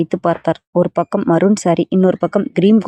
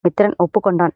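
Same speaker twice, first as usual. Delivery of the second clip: ordinary delivery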